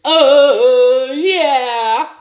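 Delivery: high, loud catchphrase cry; triumphant shout; excited whoop. Real, not acted. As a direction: belt out the celebration. Signature celebration after a pick.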